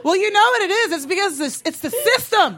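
high-pitched emphatic explaining; worked-up rant. Real, not acted. Cohost explains why nobody files complaints, worked-up high rant. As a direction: punch out the culprit, then repeat it harder. Comic rant blaming the system.

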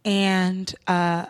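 low, measured mock-solemn address; chill, earnest speech. Speech to the cab driver after police come, chill. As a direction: deliver a hushed, hurt little speech with pauses. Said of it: "very choice, like, very chill words".

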